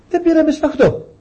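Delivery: put-on young boy asking his father; child's question. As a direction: play the innocent boy puzzled about the lamb. Child Isaac asks father.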